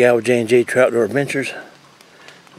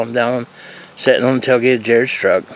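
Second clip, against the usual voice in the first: normal talking voice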